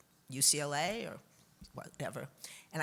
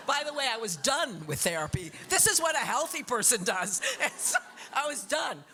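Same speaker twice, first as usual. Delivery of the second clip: laughing; 7 semitones higher than usual. Real, not acted. Laughing aside about therapy.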